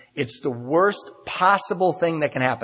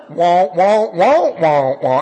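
louder mocking wah-wah, swooping high mid-run; mock unintelligible speaker; 3 semitones higher, 8 dB louder, much slower than usual. Newcomer mimics the meeting speaker he can't understand, loud mock gibberish. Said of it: "I can't even understand that guy".

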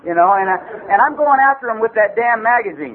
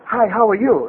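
imitating a little guy at a Japanese bar. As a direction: mimic the little fellow's greeting. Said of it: "some little guy go".

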